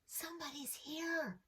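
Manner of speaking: hushed, nervous little whisper; soft whisper. A narrator's reading. Lamb whispering to Abigail in the dark stable, soft whisper. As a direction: breathe it out quietly. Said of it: "Josh whispered".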